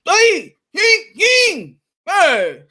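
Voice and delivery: high, loud mock yelling; hammed-up imitation of a screaming trainer; imitating Canelo screaming. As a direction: ham up a cornerman screaming. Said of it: "Canelo Alvarez was screaming at him".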